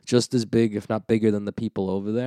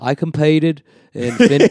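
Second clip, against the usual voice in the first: raised-pitch put-on Australian; Australian accent; 4 semitones higher, 6 dB louder than usual. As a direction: show off a mock Aussie accent. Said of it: "I have the best Australian accent".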